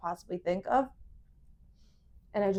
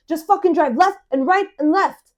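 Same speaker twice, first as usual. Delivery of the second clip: imitating a fed-up friend, higher and louder; angry friend; 6 semitones higher, 8 dB louder than usual. Drunk friend giving directions by phone, angry. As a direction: bark impatient directions, acted out. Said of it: "he's getting angrier and angrier".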